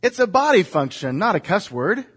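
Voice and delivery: "What?" jumps high in mock indignation, then a scolding grandmother's tone; lightly voiced indignant grandmother; indignant grandma. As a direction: play the startled old teacher defending herself. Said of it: "she said".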